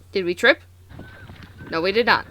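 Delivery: normal talking voice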